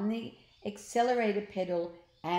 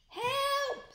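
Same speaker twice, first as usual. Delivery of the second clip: scream. Acted. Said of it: "screamed Ned".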